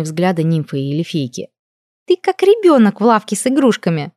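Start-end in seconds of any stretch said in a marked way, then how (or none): 2.1-4.1 high, lightly laughing tease; merry, high-pitched princess; amused. A narrator's reading. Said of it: "засмеялась Лия".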